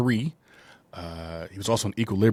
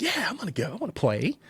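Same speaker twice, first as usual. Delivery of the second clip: much higher put-on kid voice, pleading to join; eager kid voice; 11 semitones higher, faster than usual. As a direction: squeak it out like an eager boy. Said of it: "I don't know why I'm talking, talking like that".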